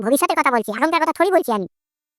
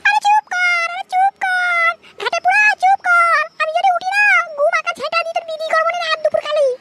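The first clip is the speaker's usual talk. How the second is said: very high, squeaky mother ordering silence; high cartoon scolding, drawn-out commands; furious mother; slower than usual. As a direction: threaten them in a piercingly high mum voice.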